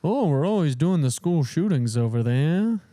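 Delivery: put-on smug Canadian accent, sing-song "Oh"; a light mock Canadian accent. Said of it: "that's Canadian".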